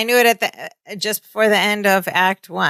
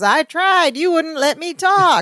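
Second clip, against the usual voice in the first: high, whiny protest; light imitation of a meek little man; mock protest; 9 semitones higher, slower than usual. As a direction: plead pitched up. [name] protesting.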